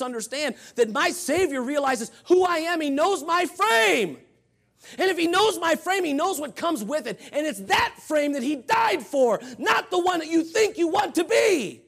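impassioned pulpit delivery; preaching at full force. Real, not acted. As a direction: drive it home from the pulpit, high and loud.

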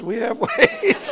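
slow words broken by a laugh; chuckling, drawn out. Retold or imitated. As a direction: laugh through the punchline. Pastor jokes, laughs.

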